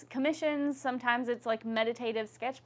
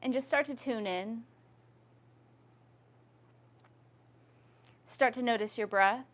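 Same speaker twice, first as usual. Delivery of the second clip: yoga instruction. Acted. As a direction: lead the class gently through breath. Leads a guided yoga breathing practice.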